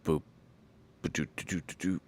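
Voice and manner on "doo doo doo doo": usual speaking voice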